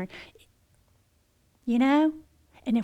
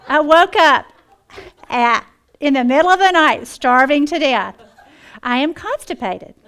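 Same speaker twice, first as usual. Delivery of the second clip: louder, aggrieved complaint, slowing on starving to death; exasperated journal reading; 3 semitones higher, 9 dB louder than usual. Retold or imitated. Reading her journal to the women, loud and aggrieved. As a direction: vent it like a hungry dieter at wits' end.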